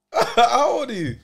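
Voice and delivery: slow, amused mocking repeat; drawn out through laughter; laughing mock question. Repeats quote slower, laughing.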